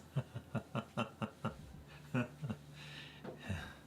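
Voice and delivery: long, trailing-off fit of laughter. Real, not acted. Streamer cracking up at a viewer's message, long laughing fit. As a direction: laugh helplessly in a stuttering run.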